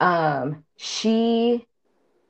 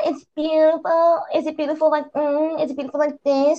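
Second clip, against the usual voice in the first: mocking teacher; 6 semitones higher than usual. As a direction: toy with her like a bully. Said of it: "he just started mocking her".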